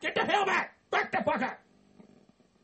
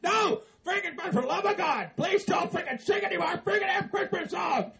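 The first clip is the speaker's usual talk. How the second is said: mock desperate cry; pleading shout; 4 semitones higher than usual. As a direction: shout higher, half laughing. Begging them not to sing.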